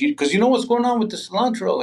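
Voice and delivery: high, fast conspiratorial bro voice; knowing bro. Retold or imitated. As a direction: toss it off high and quick, in on the secret. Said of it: "he goes".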